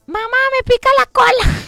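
put-on high kid's voice calling out; mild mock child yell; child shouting. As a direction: pitch up like a toddler yelling across the plane. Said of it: "gritó en todo el avión".

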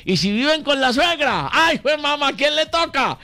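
high-pitched, insistent exclamation; exclaimed rhetorical question. Real, not acted. Host on couples living with the mother-in-law, exclaimed question. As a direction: cry it out high, driving the question home. Exclaims over who must get up.

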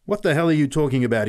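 fast, high, loud retort; rapid angry outburst; angry shout. A narrator's reading. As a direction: shout back indignantly at a kid. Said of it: "he shouted".

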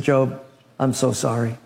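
low, somber apology; somber messenger. Acted. Messenger bringing grave news to Job, low and somber. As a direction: break the bad news gently and sorrowfully. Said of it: "comes in somber, looking very down".

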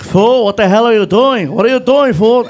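loud imitation of a yelling backseat friend; Felipe yelling. Friend in the back seat yelling at driver, loud. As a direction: yell it as the alarmed buddy. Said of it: "my friend Felipe is yelling at me".

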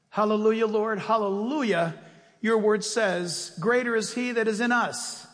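loud, impassioned pulpit prayer; fervent prayer. Real, not acted. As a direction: pray out with full-voiced conviction. Leads a closing prayer at full voice.

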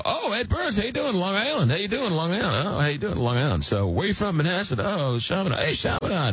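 breezy fake-cool fan chatter, hammed-up; playing it cool. Fan bumping into his idol, imitating his own forced cool. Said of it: "Playing it totally cool, totally hip".

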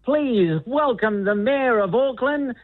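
high, drawn-out crier proclamation; town crier's shout. Shown as a demonstration. Demonstrating an announcement for the mayor, town crier's call. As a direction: call it out in long, high ringing phrases. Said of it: "shout something like".

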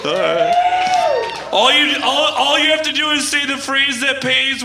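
hammed-up radio ad read; radio promo. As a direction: sell the store event like a DJ.

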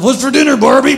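hammed-up lazy Ken; slob husband. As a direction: bark for dinner like a trashy husband. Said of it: "Ken ... sits around".

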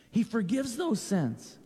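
usual speaking voice

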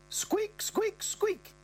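very high squeaks, mimicking bedsprings; imitated bed squeak. Mother mimics bedsprings to Brett, very high. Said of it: "she goes".

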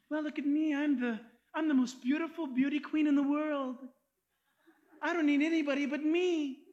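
a light hint of a preening, self-admiring lady; mildly mocking voice of a vain woman; vain beauty queen. Preacher imitates a self-absorbed beauty queen, lightly preening.

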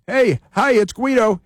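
high-pitched mock social media greeting; Guido. Host mimicking bridge protester's Twitter post, high mock greeting. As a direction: pipe up as the protester saying hello. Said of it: "He's got a Twitter".

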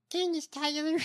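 high-pitched put-on politician voice; imitating her voice. Host trying the congresswoman's voice on a name, high imitation. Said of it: "With that voice?".

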